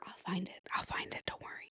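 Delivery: soft secretive whisper. Acted. Vlogger whispering to hidden lingerie on thrift racks.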